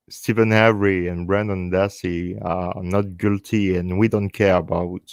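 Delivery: normal talking voice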